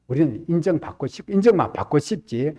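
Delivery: usual speaking voice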